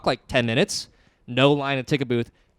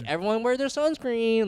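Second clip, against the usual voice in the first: mock parental singsong; parent nagging; 9 semitones higher than usual. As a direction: call out like a parent to the group. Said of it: "like literally their parents".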